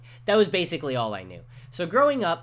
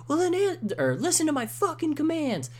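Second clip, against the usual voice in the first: much higher, louder, a mock cop barking orders; screaming cop; 4 dB louder than usual. Retelling a cop yelling at a crying kid, mock screaming. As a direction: play the cop shrieking commands. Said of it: "like, screaming it".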